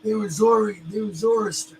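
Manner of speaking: high, mocking know-it-all voice; a high, scoffing mock of the know-it-all. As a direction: sneer it like a smug correction.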